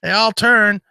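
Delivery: high-pitched mock caller; imagined caller Tim. Host imitates Tim haggling on Tradio, mock caller. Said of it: "I can hear Tim calling up".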